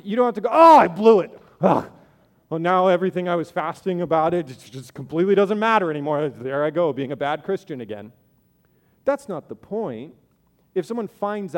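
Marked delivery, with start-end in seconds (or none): 0.5-8.1 louder, hammed-up self-pity that fades; mock despair. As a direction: wail like a caught faster, then deflate. Mock guilt after being found out.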